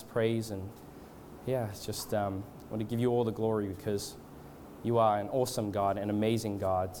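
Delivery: hushed-down, even, halting extempore prayer; leading prayer. Real, not acted. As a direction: pray aloud reverently, feeling for the words. Leads the congregation in prayer.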